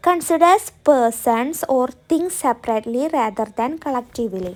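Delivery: each word spaced for writing; slow dictation. Shown as a demonstration. Teacher dictating a sentence to students, very slow. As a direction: dictate slowly so students can copy. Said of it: "dictating the definition".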